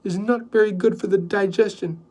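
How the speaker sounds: plain reading voice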